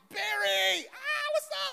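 high, loud excited yell at a star; fanboy shout. Retold or imitated. Fan spotting Barry Sanders at the airport, excited yell. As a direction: starstruck fan yelling. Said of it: "couldn't control my fanboyism".

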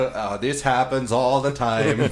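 usual speaking voice

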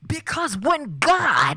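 high, slow, loud preacher voice; resonant slow sermon. Pretend. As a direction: boom it out from the pulpit. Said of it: "you just destroyed somebody's ears".

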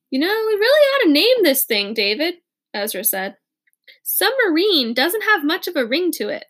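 a light tease about naming the machine; Ezra, teasing. Acted.

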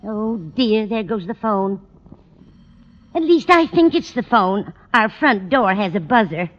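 usual speaking voice

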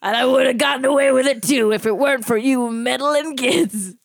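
put-on the unmasked villain curses the kids; cartoon villain. Cartoon villain's famous line.

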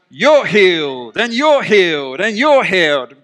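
big, swooping game-show giveaway announcement; Oprah. Acted. Preacher likening Jesus healing people to a talk-show host, exuberant proclamation. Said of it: "think of him like Oprah sometimes".

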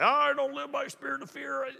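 high, put-on sing-out voice; mock pious churchgoer. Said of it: "People are like".

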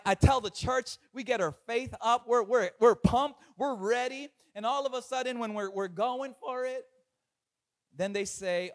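normal talking voice

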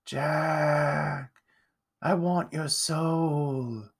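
slow, drawn-out phantom voice; haunting, menacing ghost; ghostly moan. A narrator's reading. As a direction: moan it long and eerie. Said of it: "moaned a voice".

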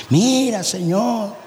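imitating a tearful plea to God; mock tearful prayer. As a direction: plead pitifully, lightly hammed-up. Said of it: "yo llorando delante de Dios". (imitando un ruego lloroso)

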